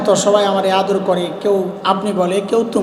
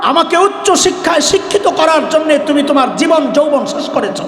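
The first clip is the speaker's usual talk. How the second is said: wailing lament to dead father; 4 semitones higher than usual. Retold or imitated. Son wailing at his father's body before the funeral, anguished high shouting. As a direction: plead with a dead father who can't answer. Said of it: "বলে".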